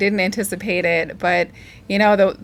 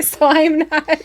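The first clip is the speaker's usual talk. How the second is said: laughing; 8 semitones higher, 3 dB louder, slower than usual. Real, not acted. Laughs about bite guard fitting.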